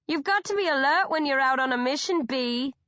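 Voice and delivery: high teasing call; crying out. A narrator's reading. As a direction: call down with mock concern. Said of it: "Ingrid cries".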